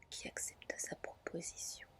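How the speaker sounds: ordinary delivery, nothing marked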